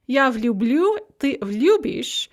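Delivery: ordinary talk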